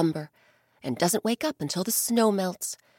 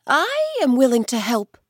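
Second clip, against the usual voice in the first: higher, louder eager bear; 6 semitones higher, 7 dB louder than usual. Acted. Bear volunteering to help the old man, higher and louder. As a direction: offer help gladly. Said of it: "the bear said".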